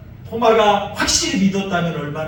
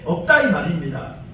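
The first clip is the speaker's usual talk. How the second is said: ordinary talk, nothing marked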